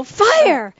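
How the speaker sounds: high urgent shout of Fire; alarmed yell. Retold or imitated. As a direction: call out fire in a panic. Retold alarm call.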